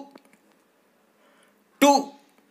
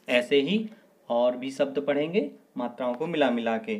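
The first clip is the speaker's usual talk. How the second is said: ordinary delivery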